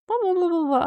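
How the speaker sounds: high, loud mocking gibberish; mock clueless tourist babble. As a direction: parody an oblivious tourist rattling off English. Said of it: "they're like".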